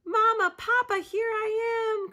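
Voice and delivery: high, loud joyful cry. Acted. Pip crying out to Mama and Papa, high and loud. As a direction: call out with all your heart. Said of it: "cried Pip".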